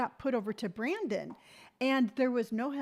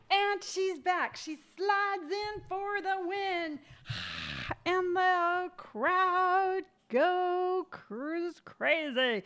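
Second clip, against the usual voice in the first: slow, hammed-up announcer call of her own comeback; mock sportscaster; 6 semitones higher, much slower than usual. Back on camera announcing herself, mock slow sports commentary.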